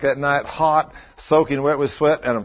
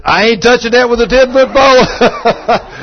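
higher, louder, slow mock-folksy voice; friend's wry reply; 8 semitones higher, 8 dB louder than usual. Husband voicing his friend Dave refusing to touch the wound, slow and loud. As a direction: drawl it out like a teasing buddy.

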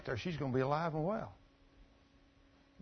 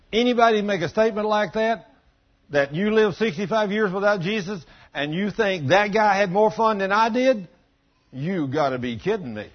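loud, raised preaching voice; full-force preaching; 3 semitones higher, 8 dB louder than usual. Acted. Preacher driving the point home to the church, loud and raised. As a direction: preach it out hard to the back pews.

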